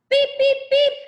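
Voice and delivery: high, loud reversing-truck beeps; truck beeping sound. Acted. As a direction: beep like a truck backing up.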